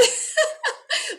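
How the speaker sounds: a high, breathy giggle at herself. Real, not acted. Amused at her own grand act, giggling high. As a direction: laugh off the grandness.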